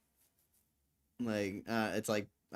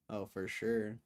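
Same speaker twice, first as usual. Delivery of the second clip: ordinary talk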